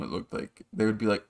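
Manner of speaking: ordinary talk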